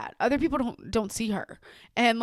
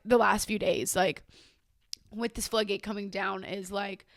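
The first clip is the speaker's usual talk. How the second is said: ordinary delivery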